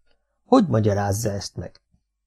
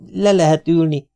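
higher, louder polite invitation; polite offer; 7 semitones higher, 5 dB louder than usual. A narrator's reading. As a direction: courteously offer a seat, lifting the voice.

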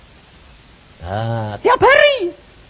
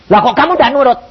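high, loud indignant demand; angry prophet; 10 semitones higher, 5 dB louder than usual. Retold or imitated. Prophet demanding why the preacher won't obey, angrily. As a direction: demand obedience as the offended prophet. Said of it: "Nabi ini marah".

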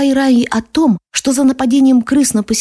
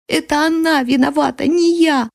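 put-on high child's protest; insistent distraught boy; 6 semitones higher than usual. A narrator's reading. Boy clutching the soldier insists he is blameless, distraught. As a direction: plead innocence in a high boy's voice. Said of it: "как заведённый повторял он".

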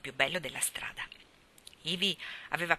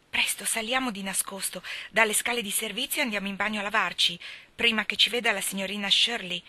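usual speaking voice

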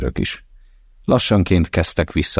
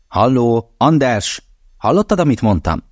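higher and louder, calling down the line; 8 semitones higher, 4 dB louder than usual. Acted. Mother calls her silent son down the phone, raised voice. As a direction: raise the voice to reach him.

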